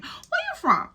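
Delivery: high-pitched, insistent retold question. Storyteller pressing the man on his origins, insistent and high.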